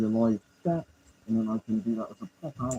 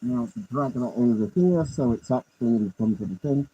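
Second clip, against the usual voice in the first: ordinary talk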